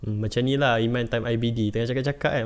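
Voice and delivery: normal talking voice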